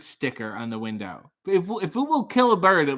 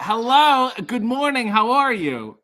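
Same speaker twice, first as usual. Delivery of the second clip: cheerful host greeting; 6 semitones higher, 4 dB louder, slower than usual. Real, not acted. Host greeting caller loudly.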